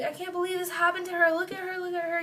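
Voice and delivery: high, quick mock-tearful wail; retold crying. Friends upset over the injured teller, imitated crying. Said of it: "Everybody's crying because they're like".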